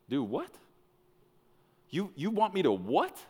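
high rising question, stammered in disbelief; incredulous, voice climbing on the question; baffled disbelief. Acted. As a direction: play a stunned man questioning his orders. Joshua baffled by God's plan.

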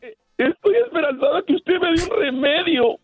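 high, hammed-up pleading wail; mock-tearful plea for help; overcome with emotion. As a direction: beg in a high, overwrought whine. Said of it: "me emocioné".

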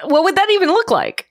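high, incredulous rhetorical question; mock puzzlement. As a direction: pitch it up as if baffled.

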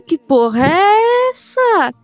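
high, slow drawn-out shock; stunned disbelief. Retold or imitated. Little girl staring at a shaking gift, high slow shock. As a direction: stretch it out in disbelief. Child's shocked reaction to toy.

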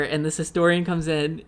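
usual speaking voice

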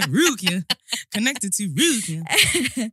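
slow, stiff robotic "Connected to Rukia"; smart speaker voice. Retold or imitated. Imitating her smart speaker announcing the friend in robotic voice. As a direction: drawl it like a device announcement. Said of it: "That's how my speaker says your name".

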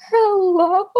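high, tense, hopeful hello; a high nervous question on the phone. Retold or imitated. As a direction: answer the phone holding your breath. Anxious answering doctor's call.

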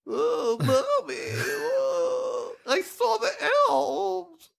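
very high, whiny mock child actor; badly acted whimpering child; young girl impression. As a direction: wail it in a falsetto little-girl voice, drawn out. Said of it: "the little girl is awful".

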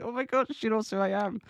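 high-pitched amazed fan squeal; starstruck. Retold or imitated. Fan realising her idol knows who she is, starstruck. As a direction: gush it high in retold amazement. Recalls amazement at being recognised.

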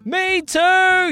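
high, drawn-out call; kid's yell. A narrator's reading. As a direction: chime in with a high holler. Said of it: "yelled Henry".

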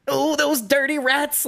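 high slow sneering villain; cartoon villain.

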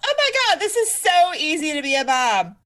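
high, breezy mock-naive voice; sing-song mock ease; mock airy younger self. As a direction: play the clueless new mom, way up high.

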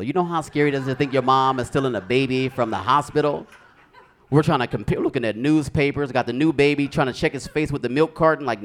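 ordinary delivery

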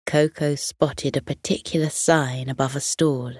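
usual speaking voice

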